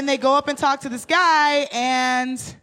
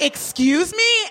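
higher, slow offended question; indignant "excuse me"; 7 semitones higher, slower than usual. Retold or imitated. Rider affronted by the driver, slow indignant question. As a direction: drag it out, affronted. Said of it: "So I was like".

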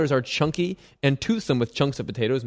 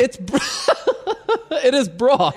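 laughing insistence; 10 semitones higher, slower than usual. Real, not acted. Laughing mid argument.

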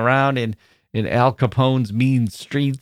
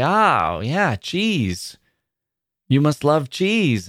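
eager stranger; 4 semitones higher, much slower than usual. Retold or imitated. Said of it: "and they would say".

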